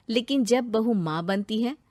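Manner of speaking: plain reading voice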